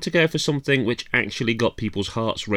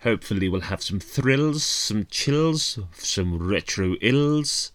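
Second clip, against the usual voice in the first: spooky host voice. Pretend. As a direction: play a creepy Halloween host. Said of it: "now it's time to drop that voice".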